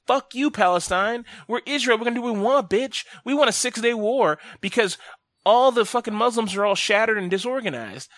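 lightly hammed bully boasting; mock taunt. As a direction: sneer it like a schoolyard bully. Acting out Israel's taunt.

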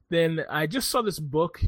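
usual speaking voice

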